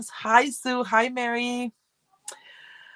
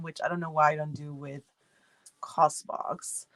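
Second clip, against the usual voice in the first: ordinary talk, nothing marked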